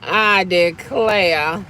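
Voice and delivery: very slow drawled I declare; theatrical drawn-out I declare; mock-genteel exclamation. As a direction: stretch it like a fainting southern belle. Repeated catchphrase.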